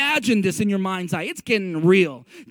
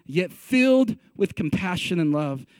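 ordinary talk

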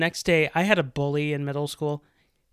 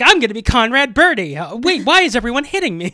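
louder, higher, gushing then confused; eager theater kid; 7 semitones higher, 9 dB louder, faster than usual. Retold or imitated. Theater kid getting bullied, loud eager gush turning baffled. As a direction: burst with stage-kid joy, then puzzle. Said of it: "I was like".

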